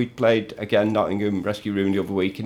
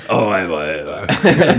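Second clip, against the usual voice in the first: failed Sean Dyche impression; 2 semitones lower, 3 dB louder, much slower than usual. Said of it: "I'm not gonna do the accent".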